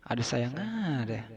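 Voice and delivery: quiet, slow ada sayang echoed in disbelief; muttered repeat. Retold or imitated. Narrator echoing the odd reply to himself, quiet and slow. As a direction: mumble it back, deadpan.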